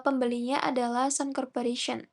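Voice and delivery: ordinary talk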